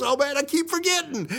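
high-pitched mock dismay; hammed-up flustered server; apologetic waiter. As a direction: exclaim it high, imitating a scatterbrained waiter. Waiter apologizes again, acted.

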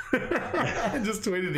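slow, words stretched out by laughter; real laughter breaking up the words; laughing. Real, not acted. Retelling the prank to a friend, cracking up.

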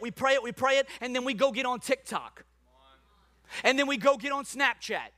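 high, building sermon delivery; fired-up preaching. Real, not acted. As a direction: press the congregation hard, building to a shout. Preaches with rising force.

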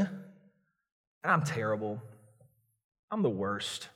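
low, soft self-loathing moan; imitated wallowing confession, low and soft; self-pitying despair. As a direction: sink into mock self-pity, voice dropping. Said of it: "We beat ourselves up before God".

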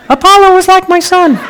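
a botched, giggly go at a movie mentor's voice; Duke voice attempt. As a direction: try the old trainer's voice, cracking up halfway. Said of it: "I can't do the voice".

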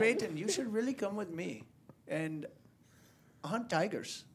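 high, lilting Nepali accent imitation. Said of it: "can I do it how he used to sound".

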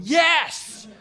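high, loud jubilant yes; inner cheer. Retold or imitated. Inner cheer at landing the painting job, jubilant yes. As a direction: burst out with a triumphant yes. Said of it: "On the inside".

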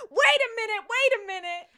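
high, loud flustered protest; breathless Cher. Retold or imitated. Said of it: "she's like".

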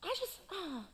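put-on sheepish young David. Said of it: "He's like".

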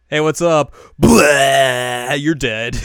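big loud villain blast. Retold or imitated. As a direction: bellow the attack. Metalia attacking, loud.

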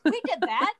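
a light send-up of a proud Welshman; mock Llewellyn boast. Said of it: "I see Llewellyn is throughout this play".

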